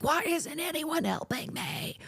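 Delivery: high, slow, pleading imitation; high distressed plea. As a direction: whine it up high like a frightened stranger.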